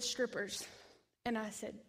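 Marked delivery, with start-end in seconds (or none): 0.0-0.7 low, soft and final; a quiet, low, certain answer from God; God says "Strippers." low and softly, falling. Acted. God answers her prayer.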